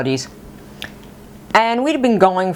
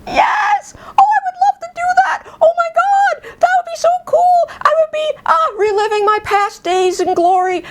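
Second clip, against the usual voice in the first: very high-pitched squealed thrill; inner excited scream. Retold or imitated. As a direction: squeal it like an overjoyed kid inside. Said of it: "inside, I'm screaming, going".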